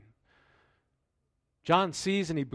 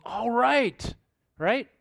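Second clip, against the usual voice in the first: higher, doubtful "All right"; skeptical Thomas; 8 semitones higher, 3 dB louder, much slower than usual. Retold or imitated. Thomas doubting the disciples' news, sceptical higher drawl. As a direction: brush off the news like an unconvinced friend. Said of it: "he goes".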